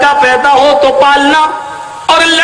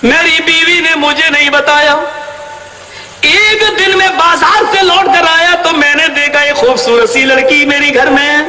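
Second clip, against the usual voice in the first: ordinary talk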